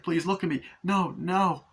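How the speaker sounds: high, mock-desperate plea; acted panicked pleading; desperate begging. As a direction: beg frantically for one last chance. Acted husband begging before blowing up.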